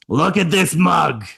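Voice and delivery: high, slow Brooklyn woman's voice; elderly New York wife drawing out words. Retold or imitated. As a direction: show it off to a husband, slowly and high. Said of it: "when I do this woman's voice".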